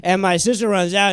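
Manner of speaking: ordinary delivery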